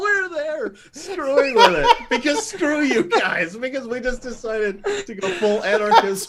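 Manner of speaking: high, slow words through laughter; laughing. Real, not acted. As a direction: barely get it out laughing. Group cracks up at the joke.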